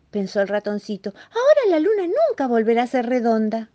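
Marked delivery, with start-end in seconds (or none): 1.3-3.7 mouse dismay. Acted. Mouse distressed at his mistake.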